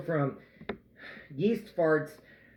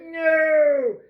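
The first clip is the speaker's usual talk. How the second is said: high, loud drawn-out wail; mock anguished shout; 11 semitones higher, 8 dB louder than usual. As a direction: cry out in comic despair. Retold reaction to daughter.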